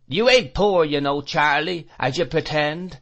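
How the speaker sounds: put-on high, loud street-boy taunts; jeering shout. A narrator's reading. Boys skirmishing about the madman's shop, taunting shouts. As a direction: call it out boldly, pitched well up. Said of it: "shouting that legend".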